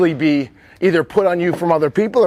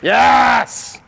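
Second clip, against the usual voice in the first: cheering encouragement; 2 semitones higher, much slower than usual. Real, not acted. Coach to player after a pick in the drill, excited cheering. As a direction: cheer on a great catch.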